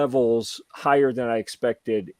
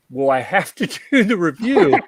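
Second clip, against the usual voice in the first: laughing; 6 semitones higher, 8 dB louder than usual. Retold or imitated. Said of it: "And I said".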